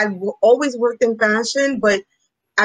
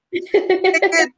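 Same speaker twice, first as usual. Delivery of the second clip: lightly imitating a Caribbean mom, higher; Caribbean mother urging, pitched up high; Caribbean accent; 7 semitones higher than usual. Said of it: "with her heavy Caribbean accent".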